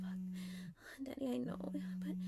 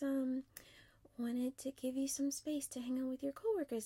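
usual speaking voice

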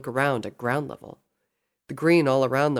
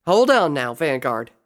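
loud, raised-voice protest; urgent intervention; 2 semitones higher, 7 dB louder than usual. Acted. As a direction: step in to stop the captain. Applejack intervenes in the standoff.

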